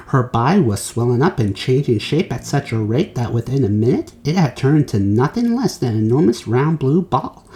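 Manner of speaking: ordinary delivery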